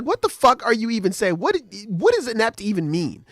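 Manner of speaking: high-pitched exasperated comeback; mock indignant outrage. Girl firing back at a boy's insult, mock indignant. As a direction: act baffled and offended, pitched well up. Acted angry comeback in a spat.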